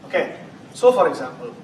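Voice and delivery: normal talking voice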